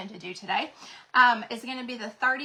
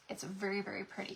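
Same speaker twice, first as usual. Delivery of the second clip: soft, low-volume aside, almost whispered; hushed admiration; 3 semitones lower, 10 dB quieter, faster than usual. Real, not acted. Crafter admiring a mold for viewers, hushed admiration. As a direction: drop to a near whisper.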